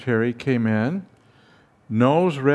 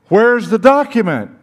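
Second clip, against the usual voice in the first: loud, high, furious question; angry demand; 8 semitones higher, 8 dB louder than usual. Retold or imitated. Dean raging at his secretary over the missing document, shouting. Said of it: "he swore at her".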